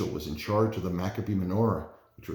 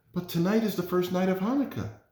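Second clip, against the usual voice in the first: put-on mild, higher-pitched upset child; understated tearful protest, pitched high; sobbing; 8 semitones higher than usual. A narrator's reading. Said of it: "sobbed Rachel".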